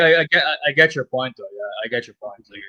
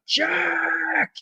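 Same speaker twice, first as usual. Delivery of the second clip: very high, hammed-up bellow. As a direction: call across the house as loud as he can. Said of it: "as loud as he could".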